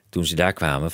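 plain reading voice

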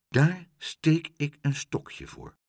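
plain reading voice

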